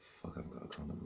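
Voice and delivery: whispered private panic; hushed panic. Retold or imitated. Man realizing in bed he has no condom, whispering panic. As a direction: whisper the panic under your breath.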